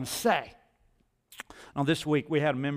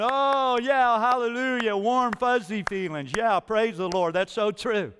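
mock churchgoer; 4 semitones higher than usual. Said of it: "we're going".

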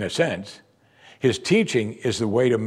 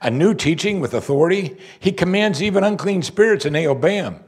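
higher-pitched, incredulous acted questioning; amazed; 6 semitones higher than usual. Crowd debating the new teaching among themselves, acted amazement. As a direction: voice the crowd's astonishment, pitch raised. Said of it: "they were all amazed".

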